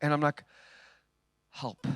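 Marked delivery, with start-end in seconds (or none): 1.5-1.9 softly retold single word "Help"; quiet desperate help; quiet plea. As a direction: drop the voice to a small helpless plea. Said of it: "That's all I said".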